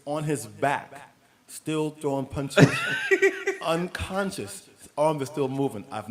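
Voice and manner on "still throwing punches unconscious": cracking up mid-story, slow and loud; laughing. Real, not acted. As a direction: laugh through the knockout story. Laughing while telling the knockout.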